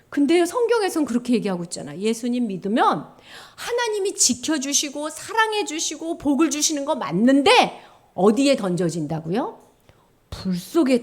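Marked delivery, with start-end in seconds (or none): none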